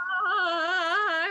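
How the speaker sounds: loud dismayed wail; horrified cry. Real, not acted. Horrified at monster image.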